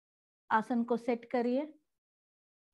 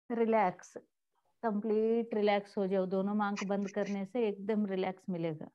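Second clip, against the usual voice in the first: guided meditation; 3 semitones lower, 3 dB quieter than usual. Real, not acted. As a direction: ease them gently into stillness, pausing between phrases.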